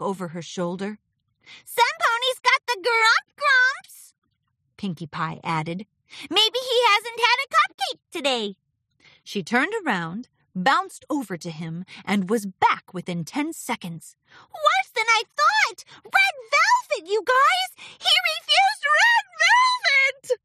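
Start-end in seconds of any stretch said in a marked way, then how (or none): 1.6-4.1 very high, loud, drawn-out pony voice; bubbly Pinkie Pie, playful. Acted. Pinkie Pie remarking on the grumpy stallion, squeakily playful. 6.3-8.6 very high, loud squeaky pony; bubbly Pinkie Pie, playful. Acted. Pinkie Pie guessing about the grumpy stallion, squeakily playful. 14.5-20.4 very high, loud cartoon shriek; alarmed squealing. Pinkie reporting a refused cupcake, shrieking in alarm.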